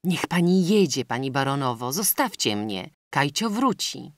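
plain reading voice

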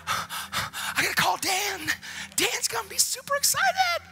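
high, drawn-out squeal; so excited. Retold or imitated. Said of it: "I was so excited about what God did".

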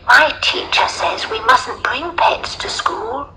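put-on high, slow little girl voice. A narrator's reading. Little girl objecting to the lion, high childlike voice.

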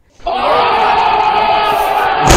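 loud high laughing scream; laughing hard at the friends; shrieking laugh. Real, not acted. Laughing shout after insult.

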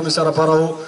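ordinary talk, nothing marked

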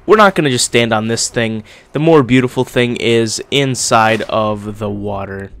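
ordinary delivery

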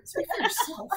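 annoyed bitten NPC calling out; loud sarcastic quip. Acted. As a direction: bark it from the background. GM voices a bitter bystander.

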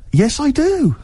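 high-pitched, eager yes; retold keen reply, pitched right up; eager acceptance. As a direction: jump at the offer in a high voice. Eagerly accepts a dodgy offer.

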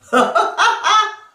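loud laugh, jumping high; throat-voice laugh. Shown as a demonstration. Coach showing the laugh climbing, loud throaty laugh. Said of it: "Après, ça monte".

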